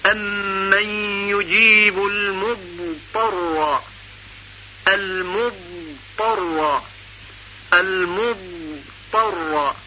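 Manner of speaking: المضطر stretched very slowly and softly on the ض; slow Quranic recitation. Shown as a demonstration. Teacher recites the verse slowly to show elongation. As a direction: chant المضطر unhurried, holding the ض.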